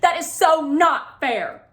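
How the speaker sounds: high, loud and slow complaint; outraged whine. A narrator's reading. Claire complaining to the coach, slow and outraged. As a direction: stretch out the unfairness indignantly. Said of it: "outrage in her voice".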